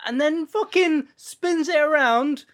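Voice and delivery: gleeful shout, slowed for effect; excited. Real, not acted. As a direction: belt it out like the best moment ever. Excitedly retelling chandelier scene.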